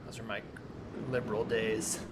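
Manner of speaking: low, hushed distrustful mutter; lightly voiced suspicious loner; paranoid stranger. [name] recalls his past.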